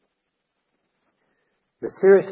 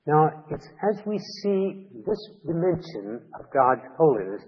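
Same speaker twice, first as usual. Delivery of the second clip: ordinary talk, nothing marked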